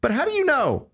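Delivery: high-pitched, worked-up doubt; exasperated protest. Real, not acted. Exasperated parent rejects reassurance.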